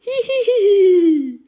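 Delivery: loud high laughing hi hi; mock girly laugh; shrill giggle. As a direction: giggle in character, rising loud. Laugh in character.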